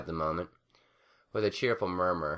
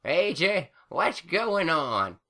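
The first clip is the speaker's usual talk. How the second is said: put-on confused old granny; 11 semitones higher, 5 dB louder, much slower than usual. A narrator's reading.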